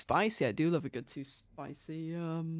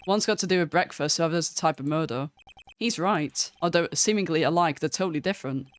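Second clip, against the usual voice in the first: louder acted voices for each game character; reading game dialogue; 2 semitones lower, 4 dB louder than usual. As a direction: perform the detective scene out loud. Voices visual novel dialogue.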